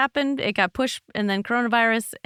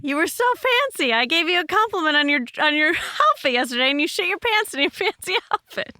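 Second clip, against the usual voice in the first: giggly teasing, pitched up high; laughing tease; 7 semitones higher than usual. Real, not acted. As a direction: laugh at him while you tease. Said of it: "teasing through laughter".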